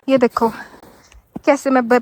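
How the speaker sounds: ordinary talk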